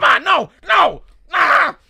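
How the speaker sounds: imitating a frantic high-pitched gamer shout; gamer yelling. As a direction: yell at the game in mock desperation. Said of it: "he's like, he's like".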